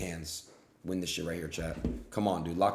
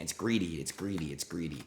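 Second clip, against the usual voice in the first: fading repetition; 4 semitones lower, 7 dB quieter than usual. Real, not acted. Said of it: "repeated, fading each time".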